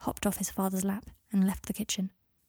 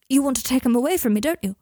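loud, fast, alarmed burst; urgent, raised voice; panicked accusation; 4 semitones higher, 9 dB louder, faster than usual. Acted. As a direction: blurt it out in terror of losing your child. Terrified father accuses Harry.

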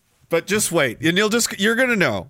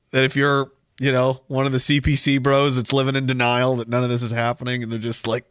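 normal talking voice

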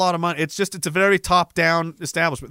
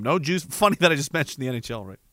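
ordinary talk

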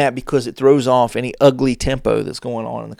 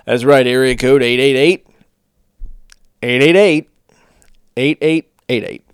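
phone number read; 4 dB louder than usual. Shown as a demonstration. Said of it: "reading a phone number out to somebody".

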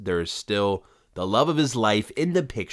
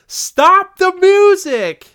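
much higher, louder, drawn-out shout; top-of-lungs yell; 13 dB louder, much slower than usual. Acted. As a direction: yell it across a whole concert venue, stretching each word. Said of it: "stands up and yells at the top of his lungs".

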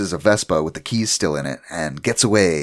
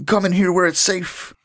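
higher pleading neighbor voice; urgent neighbor; 11 semitones higher than usual. Retold or imitated. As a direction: beg him to come inside. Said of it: "And the guy's like".

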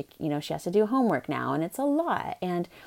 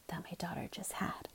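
whispered aside, as if the daughter might hear; 16 dB quieter than usual. Real, not acted. Mom confiding to listeners about her daughter, whispered. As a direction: breathe it out secretly.

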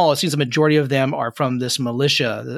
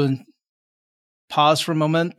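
ordinary talk, nothing marked

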